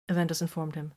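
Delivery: usual speaking voice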